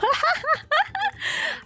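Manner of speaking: squealing giggle; high laugh. Real, not acted. Laughing to chat about cat girls.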